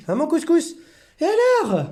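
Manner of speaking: squeaky teasing coo; high pet talk. Real, not acted. Teasing cat Couscous, very high coo.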